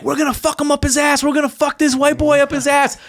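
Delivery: high-pitched mock jeering of a gang; imitated gang threats. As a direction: play a pack of taunting kids, pitch up.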